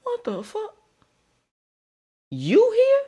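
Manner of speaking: high squeal of shock. Retold or imitated. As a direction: shriek it out in disbelief. Shocked at seeing the girl.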